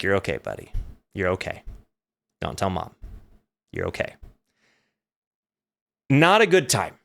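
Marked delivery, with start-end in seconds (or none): 0.0-4.2 low, soft, reassuring murmur; calm him down quietly, deep and gentle; soothing brother. Retold or imitated. Brother soothing scared Wally, low and hushed. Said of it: "And he's like".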